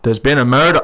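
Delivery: a first shy try at a Scottish accent; Scottish accent attempt. Shown as a demonstration. Captain nudged by host to try the line, half-hearted Scottish accent. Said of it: "can you say in a Scottish accent".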